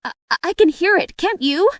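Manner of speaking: high, quick, stammering worry; nervous little creature; anxious. A narrator's reading. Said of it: "said Piglet anxiously".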